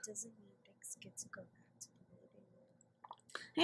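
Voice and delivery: hushed, slow whisper; soft grave whisper. Acted. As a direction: breathe the words out slowly. Dramatic line in voiced scene.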